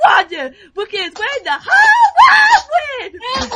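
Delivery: Wawa Wander impression. Girl doing her loud Wawa Wander impression. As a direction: shout it like a wanted poster crier. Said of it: "his Wawa Wander impression".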